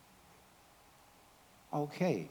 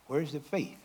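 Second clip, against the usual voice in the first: ordinary talk, nothing marked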